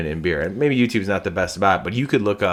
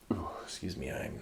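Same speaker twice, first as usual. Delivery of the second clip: quick embarrassed whisper after a burp; whispered excuse me; 2 semitones lower, 19 dB quieter than usual. Real, not acted. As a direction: whisper it under your breath.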